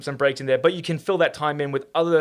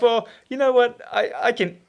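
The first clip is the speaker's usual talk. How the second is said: higher-pitched, louder retort to the warnings; defiant retort; 9 semitones higher, 4 dB louder than usual. Retold or imitated. Runner brushing off everyone's warnings, defiant retort. Shrugs off warnings about gels.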